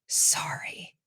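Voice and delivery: soft, voiceless wincing whisper; whispered apology. A narrator's reading. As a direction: whisper it sheepishly to Mum. Winced apology to her mother.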